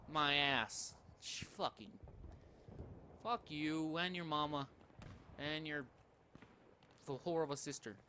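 usual speaking voice

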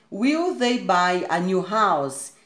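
very slow classroom reading; slow, careful example question; slow model question. Shown as a demonstration. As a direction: read it out word by word. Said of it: "Olha só o will".

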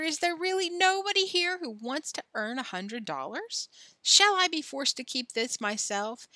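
high, rising appeal to the crowd; ringmaster's cry. A narrator's reading. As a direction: goad them with incredulous questions. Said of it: "cried the ringmaster".

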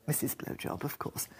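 ordinary delivery

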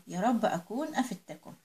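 ordinary talk, nothing marked

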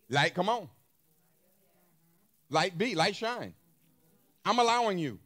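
loud, firm command to the light. Shown as a demonstration. Preacher showing God commanding light, firm and loud. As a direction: order it like a king. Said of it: "Or he could say".

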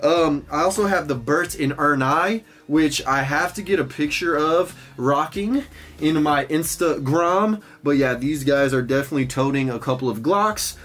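outro promo. Acted. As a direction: pitch your shop to viewers.

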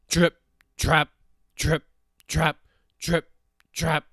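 loud, measured hoof-step chant; heavy hoof beats. A narrator's reading. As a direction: stamp out each word separately and loudly. Hooves of heavy goat on bridge.

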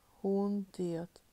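ordinary delivery

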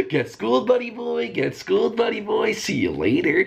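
high mocking taunt; gleeful taunt. Real, not acted. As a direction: sing it out to rub it in. Taunting the hunter.

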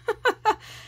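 high short laugh. Real, not acted. Joking that the paper is from China, a high short laugh. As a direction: chuckle at her own joke.

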